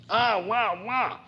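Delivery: high wailing mock bawl; imitated bawling recruits. Colonel imitating recruits strapped to horses, mock bawling wails. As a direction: wail like a terrified rider strapped on. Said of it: "You would hear them bawling".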